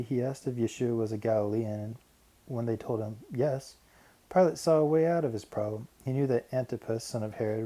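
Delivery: ordinary delivery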